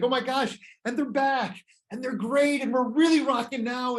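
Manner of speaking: high, excited fan gush; thrilled fan. Retold or imitated. Concert fan thrilled at encore.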